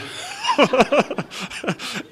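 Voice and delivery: a burst of high laughter. Real, not acted. Laughs at nephew's words.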